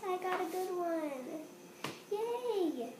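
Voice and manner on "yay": ordinary talk, nothing marked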